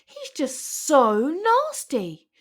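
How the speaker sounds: high, slow, drawn-out complaint; sulky lizard voice; upset little creature tattling; whiny complaint. A narrator's reading. Complaining about bully.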